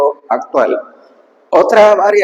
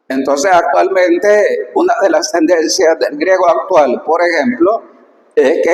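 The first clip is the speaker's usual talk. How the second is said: ordinary talk, nothing marked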